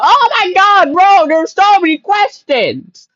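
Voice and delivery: high-pitched, exasperated cry; exasperated outburst. Real, not acted. Viewer left with so many questions, exasperated outburst. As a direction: burst out high, stretching the last words.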